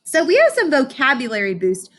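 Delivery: ordinary delivery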